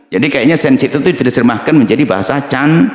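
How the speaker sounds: normal talking voice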